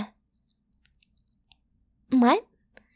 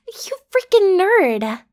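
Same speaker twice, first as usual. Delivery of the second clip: teasing jab; 6 semitones higher, 5 dB louder, much slower than usual. Acted. Teasing the tutor after his answer.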